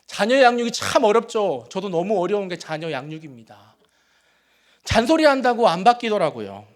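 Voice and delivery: usual speaking voice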